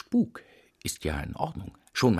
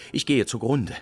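ordinary delivery

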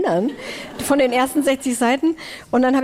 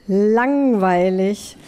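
drawn out; 2 semitones lower, much slower than usual. Real, not acted. Blunt verdict on the book.

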